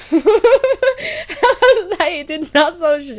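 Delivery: high, loud laughing disbelief. Real, not acted. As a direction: laugh through the words, incredulous. Laughs at useless therapy advice.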